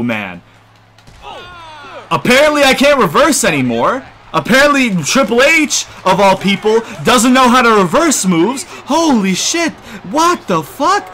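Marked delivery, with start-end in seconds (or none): 2.1-11.1 high, loud sarcastic complaint; indignant, pitched way up; outraged disbelief. Real, not acted. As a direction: rant incredulously at a cheating game. Outraged that reversals do not work.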